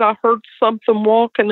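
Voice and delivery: high, imitating a scared boy; frightened son. Son telling mom what they saw, high and scared. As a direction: tell it like a shaken child. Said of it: "He said".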